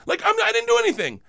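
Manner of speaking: defensive high whine. Retold or imitated. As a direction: protest your innocence in a high whine. Defending himself after she cries.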